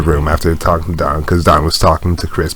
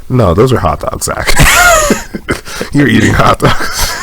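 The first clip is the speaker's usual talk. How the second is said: mock accusation; 6 semitones higher than usual. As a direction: call him out, laughing.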